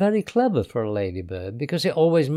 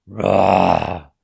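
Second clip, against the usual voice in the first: low, loud drawn-out roar; deep storybook monster growl; dinosaur roar; 7 semitones lower, 6 dB louder than usual. A narrator's reading. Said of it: "said the dinosaur".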